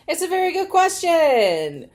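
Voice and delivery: high, loud laughing remark; amused at the reply; giggling through it. Real, not acted. Laughing at message.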